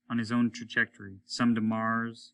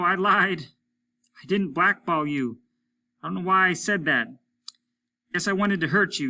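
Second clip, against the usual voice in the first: higher, louder gruff voice of Applegate; a rough spaceman calling in, raised voice; Applegate over the radio; 7 semitones higher, 6 dB louder than usual. A narrator's reading. Applegate calling Hollis over the radio, higher and louder.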